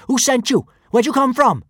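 put-on high, loud little-girl challenge; fierce demand. A narrator's reading. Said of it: "she demanded fiercely".

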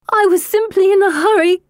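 halfway between laugh and howl; hysterical. A narrator's reading. Shaken sister excusing herself to her brother, hysterical. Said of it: "a cross between a laugh and a howl".

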